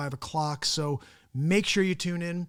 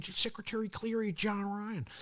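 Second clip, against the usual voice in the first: Owen Wilson impression; 5 semitones higher, 6 dB quieter than usual. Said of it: "I do an Owen Wilson saying".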